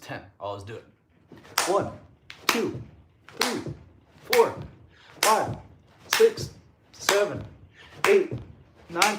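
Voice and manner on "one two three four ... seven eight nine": one short exerted word per push-up; counting between exercise breaths. Real, not acted. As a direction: count out reps on the effort. Counting push-ups while doing them.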